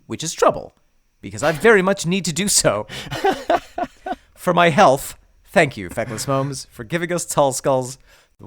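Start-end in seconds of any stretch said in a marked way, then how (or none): 2.9-4.1 high laugh. Real, not acted. Laughs at own review.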